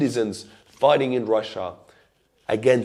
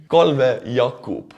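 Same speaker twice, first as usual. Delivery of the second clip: Estonian accent; 2 semitones higher, 6 dB louder, much slower than usual. Real, not acted. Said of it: "butcher their names with my Estonian pronunciation".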